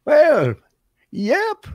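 put-on high-pitched old feller, drawn out; new character. Debuting a new character for the host, high old-feller voice. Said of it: "I got a new character".

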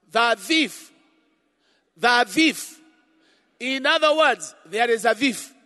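loud fervent sermon; preaching. Real, not acted. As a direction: preach it with full force. Preaching at full force.

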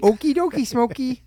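"Okie dokie, Smokey" sung out high; high playful okie dokie. Retold or imitated. Host teases his cohost's bedroom talk with high silly chirp.

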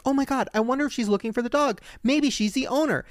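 high, hopeful wondering; hopeful realization. Retold or imitated. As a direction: wonder aloud with rising hope. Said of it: "I was like".

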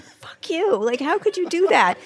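high, squeaky mock indignation; a hammed-up, high-pitched reproach; mock outrage. Bill silently reproaches a grinning Steve in a high mock outrage. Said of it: "and you're like".

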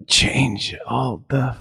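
very slow, halting injured mumble; dazed Smigel. Acted. Fallen writer resuming his task, slow dazed delivery. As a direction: drag out each word, barely conscious. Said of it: "And he goes".

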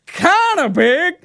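high, slow goofy drawl; Greg impression. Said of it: "Greg then says".

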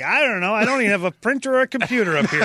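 fast loud flustered mock self; flustered self-quote. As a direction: rattle it off in a rush, caught off guard. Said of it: "I'm like".

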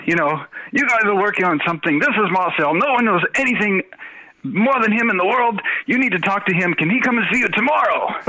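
high, pushy Welsh pitch; Welsh accent. Retold or imitated. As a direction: sell him hard like a Welsh boss. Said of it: "you've got a really good Welsh impersonation".

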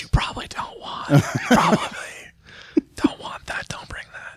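hushed conspiratorial aside, voiceless; whisper. Pretend. Co-host to listeners about the special prize, whispered. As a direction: whisper it like a secret warning.

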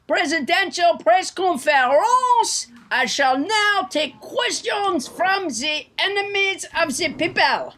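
put-on Trump with a French accent. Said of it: "French President Trump".

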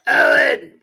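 high, loud shriek of Owen; hammed-up old mother calling her son; mock shrill mother. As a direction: screech the name like a nagging old mother. Throw Mama from the Train impression.

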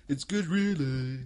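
slow, put-on Brummie; mock Brummie drawl; Brummie accent. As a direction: stretch it out like a comic Brummie. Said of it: "why is she Brummy?".

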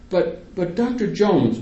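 mother stammering a protest to the doctor. A narrator's reading. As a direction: trip over the first words. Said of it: "my mother stammers".

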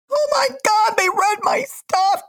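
high-pitched imitation of a thrilled fan; geeked fan. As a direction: squeal it like a starstruck fan. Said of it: "got geeked on that one".

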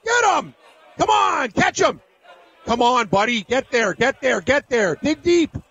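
high, frantic yelling at the horse; yelling at a horse race. Real, not acted. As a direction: scream the horse home. Screaming for his horse.

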